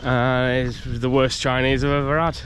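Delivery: normal talking voice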